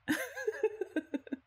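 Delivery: high bright burst of giggling; a short affectionate giggle. Real, not acted. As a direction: laugh fondly at the flustered listener. Amused at listener's shyness.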